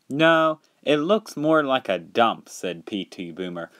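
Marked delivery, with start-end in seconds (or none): 0.1-2.5 high, louder dismissal; scornful. Acted. P T Boomer rejecting the docks to Harvey, scornful. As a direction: sneer it as a story character.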